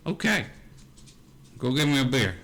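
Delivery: a hint of a bossy old mother. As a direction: order a beer like an old mom. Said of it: "She goes".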